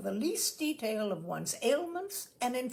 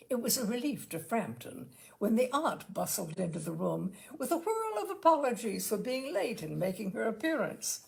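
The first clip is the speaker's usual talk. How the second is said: usual speaking voice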